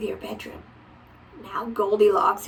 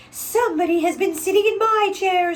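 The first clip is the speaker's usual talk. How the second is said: higher, louder mama bear; mother bear, pitched up and put out; mildly indignant mama bear; middle-sized bear; 9 semitones higher, 6 dB louder than usual. A narrator's reading. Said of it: "in her middle voice".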